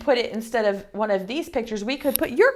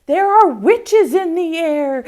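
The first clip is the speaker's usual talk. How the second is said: high, louder spooky warning; spooky pumpkin voice; 8 semitones higher, 5 dB louder than usual. Acted. Second pumpkin warning the kids, high spooky voice. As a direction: warn of witches like a wide-eyed pumpkin. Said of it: "The second one said".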